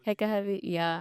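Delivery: usual speaking voice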